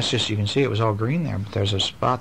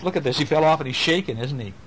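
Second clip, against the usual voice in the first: usual speaking voice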